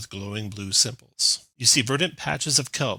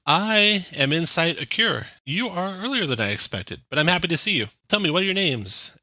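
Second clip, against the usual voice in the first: higher, measured voice of a welcoming expedition leader; tiefling leader; 7 semitones higher, 4 dB louder than usual. Acted. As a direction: speak up brightly, like a polite host greeting newcomers. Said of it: "And the tiefling says".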